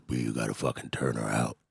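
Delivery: low, gruff pimp impression. Pimp friend urging him on, gruff. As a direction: growl it like an old street tough. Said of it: "real gruff voice, he talk like this".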